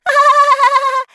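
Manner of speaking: tiny elves cackling; high giggling laugh. Acted. (тонкий хохот)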